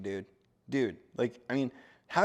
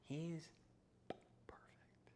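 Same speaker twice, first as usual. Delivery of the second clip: whisper; 3 semitones higher, 20 dB quieter, much slower than usual. Real, not acted. Praising the cat.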